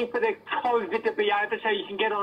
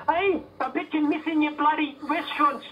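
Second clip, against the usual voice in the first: ordinary talk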